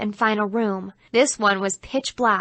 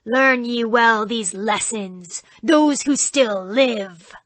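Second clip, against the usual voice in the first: very slow, louder ominous voice; doomed-souls devil; 3 dB louder, slower than usual. Acted. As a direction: intone like a haunted-house devil. Devil actor continues speech.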